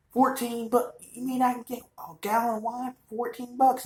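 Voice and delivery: high, hammed-up eager voice; mock excited buyer. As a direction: squeal it like a bargain hunter. Mocking a cheap wine buyer.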